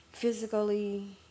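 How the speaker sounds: usual speaking voice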